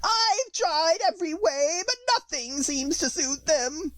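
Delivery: very high, slow pigeon voice on the edge of tears; sobbing pigeon. A narrator's reading. Pigeon laments to Alice, high slow sob. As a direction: wail it out slowly like a weeping bird. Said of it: "with a kind of sob".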